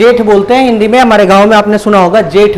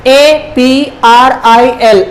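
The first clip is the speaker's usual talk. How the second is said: slow, letter by letter; spelling out; much slower than usual. Shown as a demonstration. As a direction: spell it out slowly with a gap after each letter. Spelling the month for learners. (एक-एक अक्षर धीरे-धीरे)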